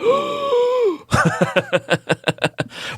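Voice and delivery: high astonished gasp; sharp delighted gasp; big gasp. Retold or imitated. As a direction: gasp in disbelief. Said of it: "I was like".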